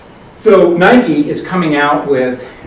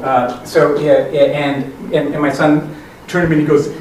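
normal talking voice

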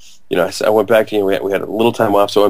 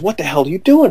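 higher, mock-exasperated demand; retold demanding question; 8 semitones higher than usual. Tall friend grabbing him by the neck, higher exasperated demand. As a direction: demand an answer, pitched up.

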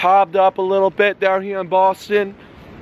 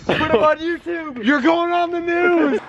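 teasing his friend after he fell; 10 semitones higher than usual. Real, not acted. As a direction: drag out the taunt.

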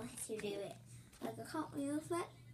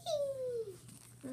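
excited whee; 11 semitones higher than usual. Real, not acted. Cartwheel for camera.

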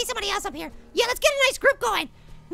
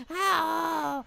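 ordinary talk, nothing marked

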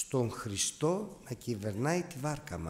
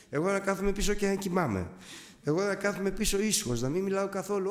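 higher, louder earnest prayer; raised, pleading prayer voice; fervent prayer; 7 semitones higher, 4 dB louder than usual. Retold or imitated. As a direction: pour out the surrender to Christ. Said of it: "Αρχίζω και προσεύχομαι".

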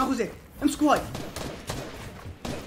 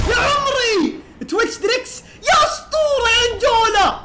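high, loud cheering for the team; excited cheer; 7 semitones higher, 3 dB louder, slower than usual. Real, not acted. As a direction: celebrate the big play with a shout. Cheering teammates' play. (هتاف حماسي عالي)

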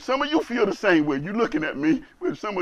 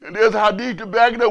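normal talking voice

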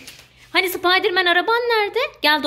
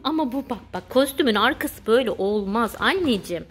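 ordinary talk

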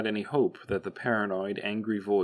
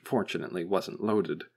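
usual speaking voice

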